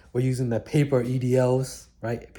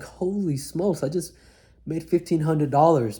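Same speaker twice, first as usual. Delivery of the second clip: amazed; 5 semitones higher than usual. Retold or imitated. Shocked at first paycheck.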